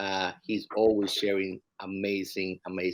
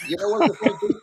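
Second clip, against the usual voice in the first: laughing shout; 5 dB louder than usual. Real, not acted. Repeated, high laughing shout.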